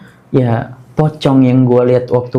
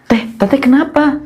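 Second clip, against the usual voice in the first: higher-pitched, anxious checking on someone; gently urgent, raised voice asking what's wrong; concerned questioning; 8 semitones higher than usual. Retold or imitated. Rider to his strange passenger, concerned questioning.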